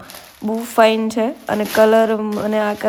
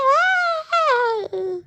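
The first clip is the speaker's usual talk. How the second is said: mock wail; much slower than usual. Mock wail after joke.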